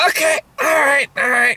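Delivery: high strained shout; mock shout. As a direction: shout it with forced patience. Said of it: "screaming that in my head".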